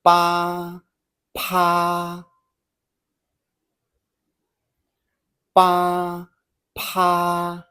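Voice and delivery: "Ba" with little air, "pa" with a big puff, both held level; contrasting puffs. Shown as a demonstration. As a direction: alternate unaspirated "ba" and aspirated "pa". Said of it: "feel the air as you repeat".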